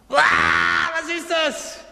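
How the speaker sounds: high, startled shriek of a frightened disciple; mock terrified scream. Preacher acting out the disciples seeing a ghost, startled high shriek. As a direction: act out the panicked cry at the ghost. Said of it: "Die haben gebrüllt".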